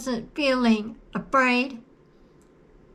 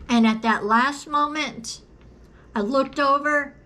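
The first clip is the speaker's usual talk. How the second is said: ordinary delivery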